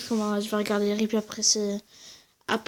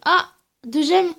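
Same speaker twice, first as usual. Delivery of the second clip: louder, higher "ah" of surprise; surprised reaction to "deux gemmes", slow and higher; pleased surprise; 5 semitones higher, 7 dB louder, slower than usual. Real, not acted. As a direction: react as if you just noticed it. Reacting to the reward.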